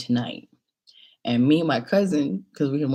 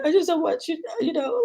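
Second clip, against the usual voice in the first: pleading friend; 12 semitones higher than usual. Retold or imitated. Friend responds in tearful talk.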